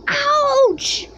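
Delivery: sharp high yelp of pain; sudden high squeal; startled ouch. Acted. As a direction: yelp as the pinch lands. Doctor pinches Papa's waist.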